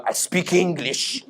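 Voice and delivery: slow, curt, dismissive; dismissive reply. Retold or imitated. French shopkeeper snubbing a French-speaking customer. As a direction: say it like a condescending waiter. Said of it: "the guy will go".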